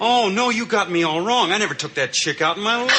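high, hurried protest; indignant denial. Acted. Peter denies dating the poet Janet named, indignantly. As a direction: protest your innocence, pitch jumping up.

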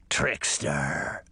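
very low, knowing beast voice; menacing wolf. A narrator's reading. Wolf sniffing out the boy, low and menacing. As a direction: size up your prey in a low voice.

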